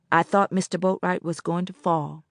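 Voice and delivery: ordinary delivery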